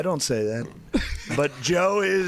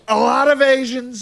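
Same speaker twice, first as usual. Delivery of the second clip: loud, higher Trump voice; Trump impression; 6 semitones higher, 8 dB louder than usual. Comedian riffing as Trump for friends, loud. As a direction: sell it like a Trump rally line. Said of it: "You got a good Trump".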